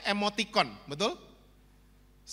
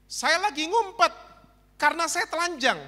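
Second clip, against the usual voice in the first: Adam hiding; 8 semitones higher than usual. Acted. Preacher voices Adam answering God, high and whiny. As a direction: sound like a caught child. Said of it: "Adam jawab".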